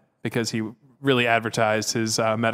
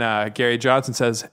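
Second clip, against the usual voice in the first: normal talking voice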